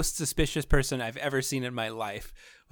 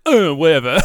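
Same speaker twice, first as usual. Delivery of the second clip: a loud, dismissive "uh, whatever"; dismissive shrug; 11 dB louder than usual. Retold or imitated. Villain waving off the heroes, loud and dismissive. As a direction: brush them off loudly. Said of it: "And he's like".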